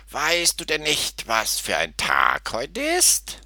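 high, slow, grumpy goat voice; bleating goat. A narrator's reading. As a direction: bleat the question out unwillingly. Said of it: "meckerte er".

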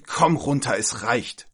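loud, fed-up order that drops off; angry snort. Acted. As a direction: snap it out, at the end of your patience. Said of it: "schnaubte sie". (wütend schnaubend)

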